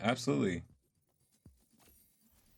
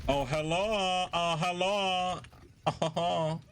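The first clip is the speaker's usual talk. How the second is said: sly greeting; 9 semitones higher, slower than usual. Real, not acted. Sneaking up on enemy as Reaper.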